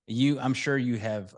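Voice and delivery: usual speaking voice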